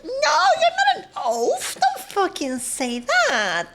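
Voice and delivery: high-pitched protest, then stretched-out words; indignant outburst. Real, not acted. Telling viewer they are not an oaf, indignant outburst. Mock outrage at viewer's self-insult.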